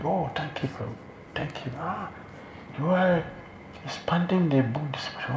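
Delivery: high, soft, gushing thanks; grateful gushing. Retold or imitated. Devotee gushing thanks to a progressing devotee, high and soft. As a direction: beam with gratitude at a devotee.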